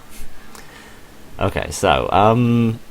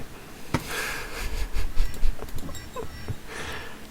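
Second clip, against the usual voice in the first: long breathy laugh; 4 dB quieter than usual. Real, not acted. Tired rambler laughs at himself.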